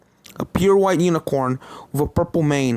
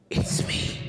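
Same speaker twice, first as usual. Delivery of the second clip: breathless whispered reveal; ghostly whisper; 7 dB quieter than usual. Acted. Applejack's spirit revealing herself to Starlight, whispered. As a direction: whisper it from the dark.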